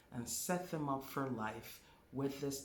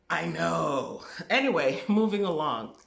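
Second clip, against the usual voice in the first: loud brush-off; 3 semitones higher, 10 dB louder than usual. Real, not acted. Teacher waving away viewers' scientific corrections, loud brush-off. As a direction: cut the objection off loudly and move on.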